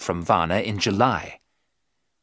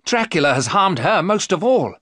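loud, high, indignant; angry protest; 9 semitones higher, 5 dB louder than usual. Acted. Jonathan protesting angrily to the professor. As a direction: press the point hard. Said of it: "Jonathan cried angrily".

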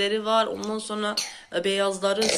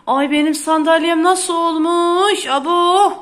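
gasping, louder, higher dismay; gasp of shock; 6 semitones higher, 11 dB louder, slower than usual. Real, not acted. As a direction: gasp then cry out at the chair. Shocked at ruined chair. (sandalyeyi görünce şaşkın bağırış)

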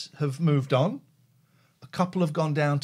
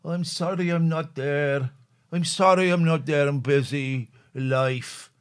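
snivelling voice; 2 semitones lower, slower than usual. Retold or imitated. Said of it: "cowardly message ... snivelling".

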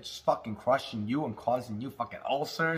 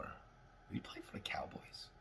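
whisper; 23 dB quieter than usual. Real, not acted. Quietly wondering aloud, unsure.

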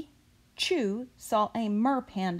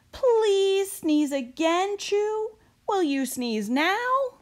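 higher, slow, begging; pleading seller; 11 semitones higher, 4 dB louder, slower than usual. Acted. Ice cream seller pleading with Chew to sneeze, higher. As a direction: coax him gently but urgently.

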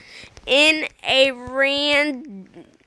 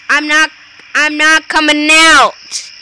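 loud stubborn shout; loud refusal; 4 semitones higher, 10 dB louder than usual. Real, not acted. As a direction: shout it defiantly.

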